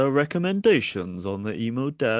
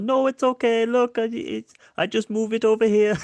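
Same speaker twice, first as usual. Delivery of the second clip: much higher, louder sweet innocent voice; hammed-up innocent strongman; mock innocent; 14 semitones higher, 6 dB louder than usual. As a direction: play the harmless old man.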